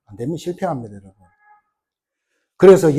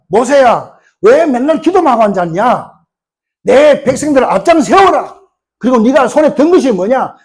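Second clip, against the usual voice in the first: booming divine command, raised and louder; God's voice; 5 semitones higher, 5 dB louder than usual. Acted. God rebuking Moses, in a loud commanding voice. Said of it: "그러니까 하나님은 합니까?".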